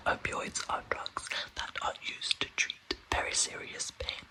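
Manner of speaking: ordinary talk, nothing marked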